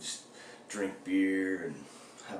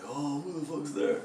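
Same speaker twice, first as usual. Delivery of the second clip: high-pitched mock alarm; imitated startled fright; 7 semitones higher than usual. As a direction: imitate someone waking up spooked. Imagined roommate waking up startled.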